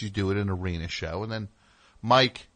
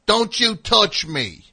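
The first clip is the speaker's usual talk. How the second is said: slow, high and loud, drawn out; slow, loud, high-pitched warning; mock cop yell; 11 semitones higher, 8 dB louder, slower than usual. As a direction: bark it slowly like a touchy bully. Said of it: "He goes".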